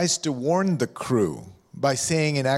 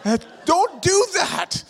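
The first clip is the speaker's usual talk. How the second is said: mock scolding, much higher and louder, slow on don't do that; hammed-up exasperated parent; Pakistani voice scolding; 12 semitones higher, 4 dB louder than usual. Preacher voicing the parent in his head calling him an idiot, mock Pakistani accent. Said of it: "this Pakistani voice in my head".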